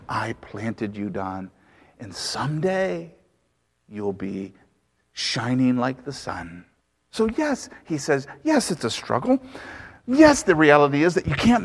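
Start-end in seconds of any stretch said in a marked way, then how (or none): 0.1-6.6 hushed tender promise. Acted. Jesus speaking tenderly to Don.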